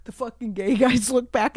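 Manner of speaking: usual speaking voice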